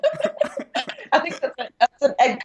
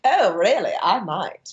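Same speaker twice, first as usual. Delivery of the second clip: Katharine Hepburn; slower than usual. Acted. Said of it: "do my terrible Katharine Hepburn".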